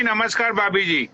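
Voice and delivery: ordinary talk, nothing marked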